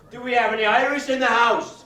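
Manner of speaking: loud call-out in an Irish persona; calling to the crowd. Acted. Host in Irish persona calling out to the audience, loud. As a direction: rouse the house with a big question.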